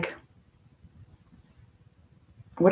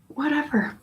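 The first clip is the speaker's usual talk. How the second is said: "Whatever." pitched much higher and softer, falling off; dismissive whatever; 9 semitones higher, 5 dB quieter than usual. Pretend. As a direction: toss "Whatever." up high and let it drop softly. Repeats the word to contrast.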